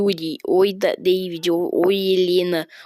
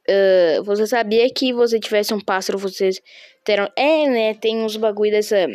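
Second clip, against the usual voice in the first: ordinary talk, nothing marked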